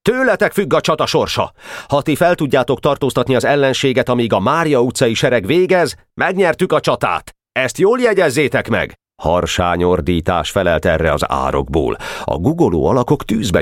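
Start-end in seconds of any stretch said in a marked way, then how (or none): 0.1-9.0 high, spirited address to troops; fervent commander's pep talk; enthusiastic rallying speech. A narrator's reading. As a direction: rouse them in a raised, eager pitch. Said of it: "kiáltott lelkesen".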